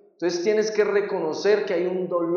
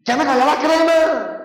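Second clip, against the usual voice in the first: high, louder angry shout; mock yelling parent; 9 semitones higher, 5 dB louder than usual. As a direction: bark at the kids over a brush. (grito de padre enojado, más agudo y fuerte)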